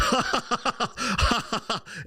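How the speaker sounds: high, put-on burst of ha-ha-ha; imitated crowd laughter. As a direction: act out a room cracking up. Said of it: "everyone laughs".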